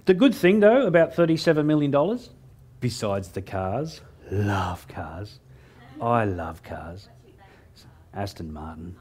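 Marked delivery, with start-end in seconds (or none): 2.8-9.0 soft, low and slow, savouring the words; dreamy whisper. Real, not acted. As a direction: breathe it like a confession of love. Dreamy over his love of cars.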